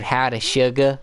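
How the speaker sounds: Southern belle greeting, slightly high; Southern accent. Retold or imitated. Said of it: "With her Southern accent".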